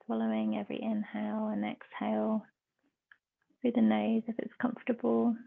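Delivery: measured meditation voice with long pauses; guided meditation. Real, not acted. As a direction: guide them into stillness, phrase by phrase.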